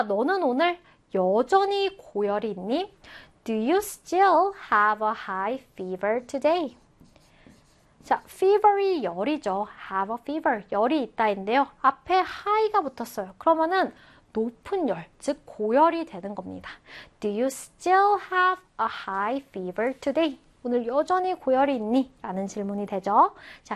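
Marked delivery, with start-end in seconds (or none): 3.4-6.8 slow, careful teacher's English; drawn-out, evenly spaced words; slow model reading. Shown as a demonstration. Teacher models English example sentence. 17.2-20.4 slow, careful teacher's English; drawn-out, evenly spaced words; slow model reading. Shown as a demonstration. Teacher repeats the example sentence.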